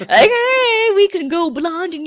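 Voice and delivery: high, sing-song mock taunt; hammed-up playground taunt. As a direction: tease them in a high, stretched-out voice. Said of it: "taunting him".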